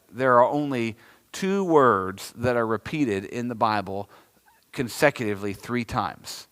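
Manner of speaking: ordinary talk, nothing marked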